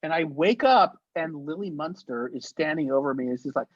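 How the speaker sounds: ordinary delivery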